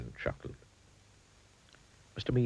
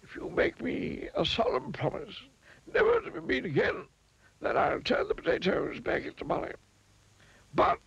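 very high-pitched, stern wizard voice. A narrator's reading. Wizard setting terms for Mister Mean, stern. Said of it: "he added sternly".

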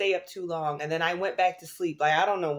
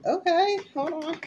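excited squeal; 11 semitones higher, 4 dB louder than usual. Real, not acted.

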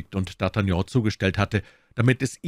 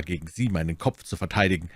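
ordinary delivery

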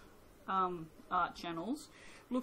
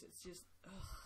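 soft disgusted ugh; queasy groan of disgust; nauseated ugh; 16 dB quieter than usual. Real, not acted. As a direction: groan at the smell. Nauseated by soap smell.

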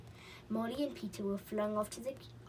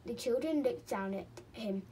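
ordinary delivery

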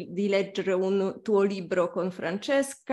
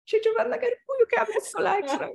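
high, laughing, thrilled fan; giddy excitement; 11 semitones higher than usual. Retold or imitated. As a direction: squeal it like a starstruck fan. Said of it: "andavo in giro". (emozionata e ridente)

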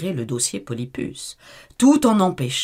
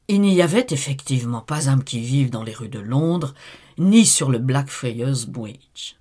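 usual speaking voice

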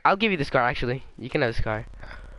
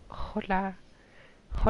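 higher, softer silly voice; put-on hola; 4 semitones higher, 6 dB quieter than usual. Friend repeats his hello in a higher, softer goofy voice.

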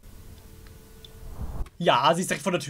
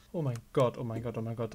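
frightened; 5 semitones lower, 8 dB quieter than usual. Real, not acted. Scared by Foxy.

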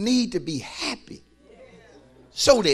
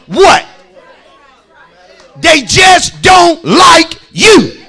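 loud, slow, hammered-out sermon lines; full-force preaching; 12 semitones higher, 17 dB louder, slower than usual. Acted. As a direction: preach it hard to the whole church.

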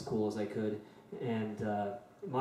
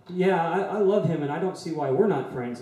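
higher, louder eager "Yeah" rushing on; eager Myra; 5 semitones higher, 5 dB louder than usual. Retold or imitated. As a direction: jump in with an eager "Yeah, I love him". Myra answers him eagerly.

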